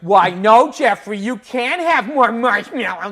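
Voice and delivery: loud, slow, sing-song mock parent voice; cute loud parent. Imitating a loud showy parent scolding her kid, cute and slow. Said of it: "talk really cute and loud to their kids".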